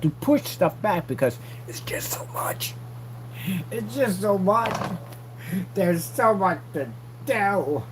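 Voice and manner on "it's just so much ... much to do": high, strained, drawn-out lament; mock overwhelmed. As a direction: squeeze it out slowly as if swamped. Acts overwhelmed by too much work.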